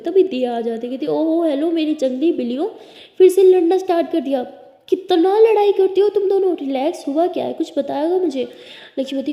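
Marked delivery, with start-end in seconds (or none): 1.1-8.5 much louder and higher, playful; teasing scolding. Acted. Diya walks in on her fighting friends, loud playful teasing. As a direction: tease the two like wild cats.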